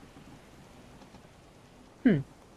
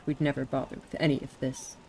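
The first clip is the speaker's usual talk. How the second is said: ordinary talk, nothing marked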